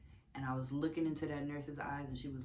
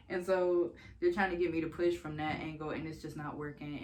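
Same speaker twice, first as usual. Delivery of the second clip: usual speaking voice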